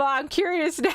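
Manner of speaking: high, giggly teasing curiosity; teasing laughter. Real, not acted. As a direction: break into a high laugh mid-sentence. Teasing chat over stray message.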